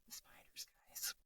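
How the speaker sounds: barely audible mock-horrified whisper; hushed, voiceless aside to listeners; whispered dread. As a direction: whisper it as a comic confession of spider fear. Jokey dread of Australian spiders.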